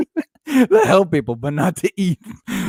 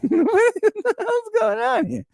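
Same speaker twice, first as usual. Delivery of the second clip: high slow mock voice; slower than usual.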